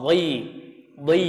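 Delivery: dhi said with a thick emphatic dh; dha demo. Shown as a demonstration. Teacher models the dha syllable for students. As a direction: keep dhi heavy with the tongue up. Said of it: "Huruf dha".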